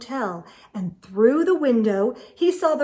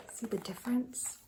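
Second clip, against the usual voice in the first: whisper; 14 dB quieter, faster than usual. A narrator's reading. Aside to listener in whisper.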